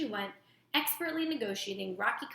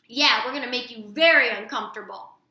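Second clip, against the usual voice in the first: loud; 10 dB louder than usual. A narrator's reading. Brother echoes threat.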